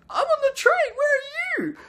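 squeaky, very high mock wailing; tearful wife. Crying wife confessing she is on the train, mock wail. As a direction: bawl it in a hammed-up falsetto. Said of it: "I understand that cry anywhere".

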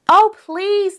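loud, high oh please; pleading shout. A narrator's reading. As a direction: beg at the top of their voices. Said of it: "they shouted".

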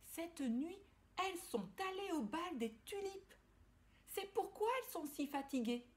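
high little ladybug voice; chirpy tiny insect telling a secret. Acted. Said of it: "dit Belle la coccinelle".

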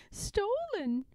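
high little voice, as if chewing; a hint of talking through porridge; mouth full. Pigwig answers Pigling through a mouthful, lightly. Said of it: "with her mouth full".

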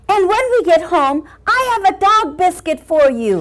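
put-on high little boy voice. Boy talks to Scotty walking home, little boy voice. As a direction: promise a treat as a small boy.

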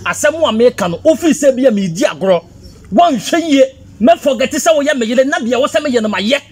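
angry quarrel. Acted. Husband confronting his wife about last night, angrily. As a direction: confront them, loud and high.

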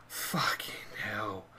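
slow exasperated whisper; whispered curse. Real, not acted. Gamer whispering in defeat after another clearance. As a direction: breathe the curse out under your breath.

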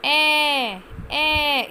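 ए held long and loud; teacher stretching the ए sound loudly; long vowel demo. Shown as a demonstration. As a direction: sing out a long ए. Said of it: "इसमें लंबी sound आ रही है ना".

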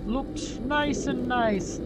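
high, slow mock Jamaican accent; playful put-on Jamaican accent. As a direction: mimic the developers in a Jamaican accent. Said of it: "Why am I doing a Jamaican accent?".

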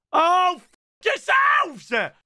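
high, loud frustrated shout; angry outburst. Real, not acted. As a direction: explode at the game.

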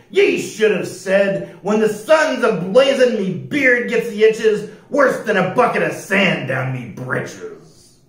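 loud, high pirate holler, falling at the end. A narrator's reading. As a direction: holler it after the man like a pirate captain. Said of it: "Pete hollered".

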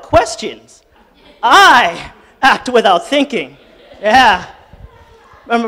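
laughing, loud teasing interruption; playful correction. Real, not acted. Congregant teasing the pastor about Vic, laughing. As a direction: tease the pastor with a grin.

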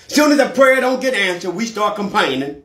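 rising, shouted sermon climax; preaching at full force. Acted. Preacher building to a cry before the congregation.

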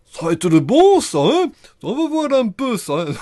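loud, high, badly faked Russian accent; attempted Russian accent. Shown as a demonstration. Meneur cherche son accent russe, faux accent russe. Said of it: "comment est-ce qu'il est mon accent russe déjà".

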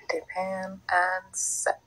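slow whisper. Real, not acted. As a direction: whisper each word slowly. Applying powder.

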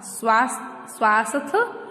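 "स्वास्थ्य" cut short as "swaas-th", without the ya; wrong pronunciation. Shown as a demonstration. Teacher quoting the incorrect word, mispronounced. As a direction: leave off the final ya of "स्वास्थ्य". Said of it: "अशुद्ध word था".

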